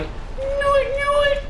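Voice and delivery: very high tiny penguin squeak; little penguin voice. Retold or imitated. Imagined penguin answers the catchphrase, very high squeak. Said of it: "the little penguin going".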